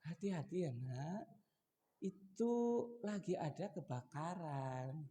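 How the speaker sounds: put-on soft, slow parent cautioning a child; mock-calm, drawn-out fire warning; too-gentle warning. Shown as a demonstration. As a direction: warn the child of fire with no urgency at all. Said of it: "ya pasti nadanya terangkat".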